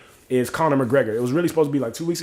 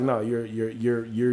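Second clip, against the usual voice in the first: ordinary delivery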